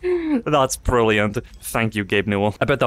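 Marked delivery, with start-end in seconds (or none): none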